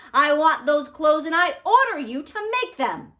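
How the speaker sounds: angry king shouting an order; scowling king. Acted. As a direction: stamp your foot like a spoilt king. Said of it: "The king's face was a twist of scowls".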